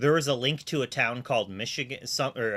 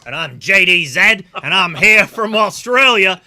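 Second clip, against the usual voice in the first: loud, high, hammed-up Australian accent; 9 semitones higher, 14 dB louder than usual. Caster introducing himself to viewers in a mock Australian accent. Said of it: "with our Australian accents today".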